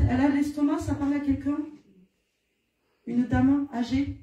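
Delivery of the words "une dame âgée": usual speaking voice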